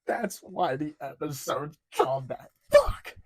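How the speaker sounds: sudden realization ending in a loud yelled curse; thrilled outburst at the title drop; excited realization. Retold or imitated. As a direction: relive the moment it clicked and shout the curse. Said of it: "I went".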